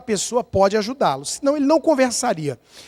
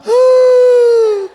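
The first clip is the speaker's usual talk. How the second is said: high, loud exaggerated "Ah!"; hammed-up gasp of disbelief; mock amazed shout; 12 semitones higher, 7 dB louder than usual. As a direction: yell it out like feigned astonishment. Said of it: "você fala".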